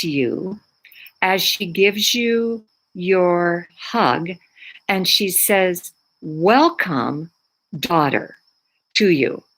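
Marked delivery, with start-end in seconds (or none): none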